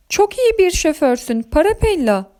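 tiz, hayran karakter sesi; hayranlıkla. A narrator's reading. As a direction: ince sesle hayranlığını belirt. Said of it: "dedi Zambazilla hayranlıkla". (hayranlık dolu övgü)

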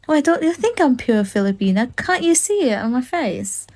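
ordinary talk

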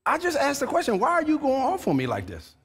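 protest, stunned and hurt; offended surprise. Retold or imitated. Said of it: "you're like".

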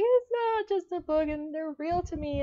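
a touch of upset pleading, pitched high; half-crying insistence that they matter; tearful protest. Retold or imitated. To her coworker, insisting the characters are real, pitched high and half-tearful. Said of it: "And I'm just there like".

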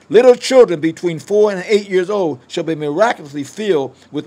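loud, declaiming prophecy to the congregation; prophetic preaching. Acted. Preacher proclaiming prophecy to the church, booming. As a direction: thunder out the word of the Lord.

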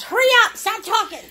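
high, loud impatient dog voice; bossy dog voice. Pretend. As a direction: voice the dog bossing Mom around. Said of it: "Oh, she says".

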